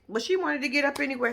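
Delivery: usual speaking voice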